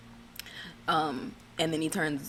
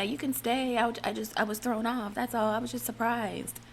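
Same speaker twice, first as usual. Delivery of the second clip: raised, high-pitched retold reassurance; put-on high voice, explaining herself away; placating imitation; 7 semitones higher than usual. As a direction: mimic a wife backpedalling in a higher voice. Wife placating husband in retold quote.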